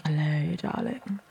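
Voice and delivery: low, soft sultry hello; sexy voice. Retold or imitated. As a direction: purr it like a smooth seductress. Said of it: "some people do just have that".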